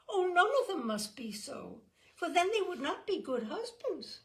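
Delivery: a hint of a earnest child voice; lightly voiced young girl. A narrator's reading.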